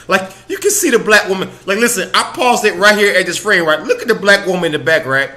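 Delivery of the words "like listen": ordinary talk, nothing marked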